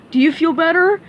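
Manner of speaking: lightly voiced dopey starfish; Patrick voice. Patrick agrees to play doctor for SpongeBob, dopey eager voice.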